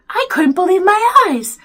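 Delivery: usual speaking voice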